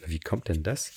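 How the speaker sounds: normal talking voice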